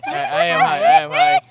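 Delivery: high giggling laugh-talk. Real, not acted. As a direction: squeal it through giggles. Giggling after flubbing the intro.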